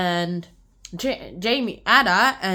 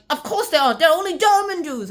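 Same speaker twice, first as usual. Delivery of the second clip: high, loud acted comeback; emphatic retort; 6 semitones higher, 7 dB louder than usual. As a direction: come back forcefully, pitch raised. Acting her emphatic retort.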